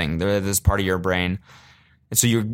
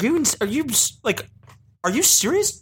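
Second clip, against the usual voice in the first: high squeaky disbelief, laughing; can't believe it, voice shooting up high; incredulous laughing disbelief. Retold or imitated. To the stopped traveller, high laughing disbelief. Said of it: "I just started laughing 'cause I was like".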